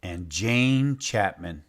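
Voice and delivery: slow storybook title; title reading. Acted. Teacher opening a picture book, slow title read. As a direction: announce the book slowly.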